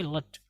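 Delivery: लट as a short "lut"; corrected ending. Shown as a demonstration. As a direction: say लट with a schwa, not "e". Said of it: "but ये पायलट है". (लट, छोटे "अ" के साथ)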